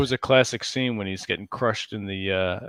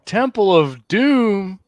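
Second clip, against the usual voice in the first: slow, loud dramatic title; movie trailer voice; 8 semitones higher, 5 dB louder, much slower than usual. Pretend. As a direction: boom out the title.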